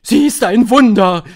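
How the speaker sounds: high, very loud adoring cry; high shout of admiration; crowd shouting. A narrator's reading. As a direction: shout out in awe like a whole audience. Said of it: "schrie das Publikum".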